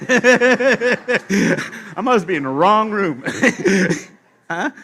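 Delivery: slow, loud, laughing words; laughing disbelief. Pretend. Newcomer sees Hitler at heaven's welcome table, laughing. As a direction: laugh at the absurdity.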